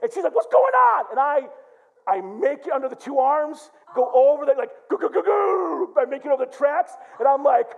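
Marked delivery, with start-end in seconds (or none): 0.3-1.0 high, loud alarmed wife; shrieked worried question, acted; alarmed shout. Wife reacts to his yelling. 4.9-5.9 high, slow repeated bumping sounds; loud comic sound effect; bumping car noise. Retold or imitated. Imitates car crossing tracks.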